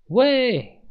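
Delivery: high, drawn-out shriek; screamed call. A narrator's reading. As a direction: shriek to get attention. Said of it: "我尖叫道". (尖声大叫)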